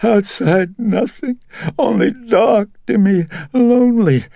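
high, loud, tearful pleading; frightened and tearful. A narrator's reading. Mother begging her son not to cast her out, sobbing. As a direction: cry out to him, terrified of the dark. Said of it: "grew frightened and tearful".

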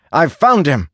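high-pitched call to comrades; urgent shout. A narrator's reading. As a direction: call it out across a battlefield. Calling out on finding wounded man.

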